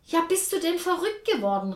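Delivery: high, loud outraged cry; shouting into the phone. A narrator's reading. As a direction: yell it into the receiver. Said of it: "rief Jessica in den Hörer".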